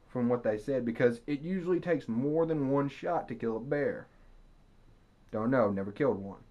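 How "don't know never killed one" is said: ordinary delivery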